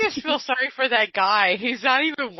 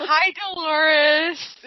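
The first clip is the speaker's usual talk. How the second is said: drawn-out greeting; 4 semitones higher, much slower than usual. Real, not acted. Greeting a caller who joins.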